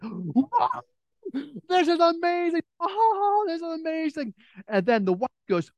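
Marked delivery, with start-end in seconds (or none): 0.0-4.3 put-on high, breathless kids' voice, close to tears of joy; the kids squeal in wonder at the candy; amazed kids. Kids gasping at the tossed candy, high and teary with joy.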